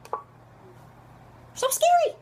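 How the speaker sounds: very high, drawn-out whine; hammed-up high-pitched fright; mock-frightened squeal. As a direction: squeak it out slowly in pretend fear. Mock fear, repeating scary.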